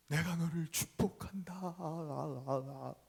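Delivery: very slow, distant echo voice; echoing blessing. Acted. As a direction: let it come back slowly from far away. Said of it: "더 큰 축복의 소리가".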